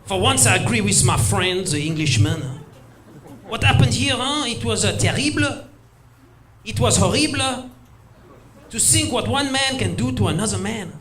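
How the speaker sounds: put-on a dramatic French accent lamenting. Frenchman agreeing with the Englishman in a hammed-up French accent. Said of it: "The Frenchman".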